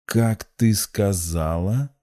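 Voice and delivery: slow, menacing question with a rise; through gritted teeth. A narrator's reading. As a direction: draw the question out tensely. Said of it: "процедил Владимир".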